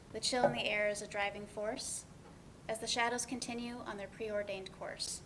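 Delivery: gentle spoken poem over music; poem recital. Acted. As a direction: read it like a wistful poem.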